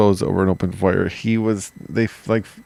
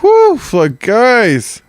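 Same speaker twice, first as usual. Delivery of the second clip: high whooping cheer, much louder; triumphant whoop; 14 semitones higher, 6 dB louder, much slower than usual. Retold or imitated. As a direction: whoop it out like you just made it. Acted victory cheer on beach.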